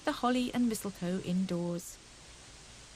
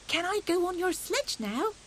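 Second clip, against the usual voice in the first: put-on much higher childlike plea; eager little hedgehog; 9 semitones higher than usual. A narrator's reading. Fuzzy Peg begging Hare for a ride, eager high voice. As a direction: ask hopefully like a small child.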